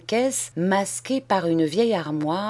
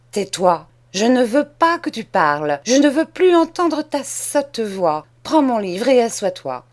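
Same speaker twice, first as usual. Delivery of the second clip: loud, raised, cutting commands; angry orders; 4 semitones higher, 5 dB louder than usual. Acted. Mean cousin orders Charles to be silent, loud and harsh. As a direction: shut the boy up sharply.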